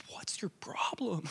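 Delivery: whispered thought; hushed unvoiced aside; inner whisper. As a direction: breathe it under your breath as a private thought. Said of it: "Like, in my head".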